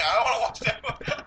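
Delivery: high-pitched teasing imitation through laughter; laughing mock refusal. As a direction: mimic a friend's refusal, cracking up. Teasingly quotes co-host's refusal, laughing.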